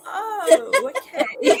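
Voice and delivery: mock high-pitched "oh, okay" through laughter; giggly put-on awkward acceptance; imitated reaction. Mimicking Eileen's reaction to the accent, laughing and high. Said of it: "Eileen's face was like".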